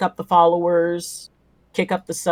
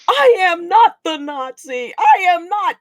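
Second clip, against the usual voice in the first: high-pitched, appalled protest; indignant high wail, hammed up; mock outrage; 13 semitones higher, 3 dB louder, slower than usual. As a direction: squeal it in comic horror. Horrified at being compared to Bailey.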